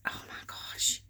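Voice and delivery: breathless awed whisper; whispered awe. Real, not acted. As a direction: breathe it out, moved. Moved by handmade tags.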